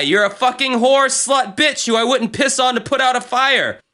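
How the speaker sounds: high-pitched acted tirade; hammed-up, exasperated outburst; furious comeback. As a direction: let the insults build in mock fury.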